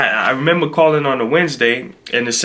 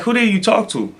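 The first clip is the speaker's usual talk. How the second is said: higher-pitched puzzled question; mock confusion; 8 semitones higher than usual. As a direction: imitate her, totally baffled. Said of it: "now she's sounding all confused".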